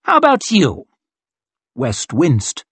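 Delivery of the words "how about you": high, raised public challenge; showy officer singling out a man; calling out. A narrator's reading. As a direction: throw the challenge across to him. Colonel calls out his next challenge.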